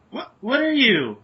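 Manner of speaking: high, loud, drawn-out astonishment; startled question. Acted. Lily steps back from a shadowy figure, startled.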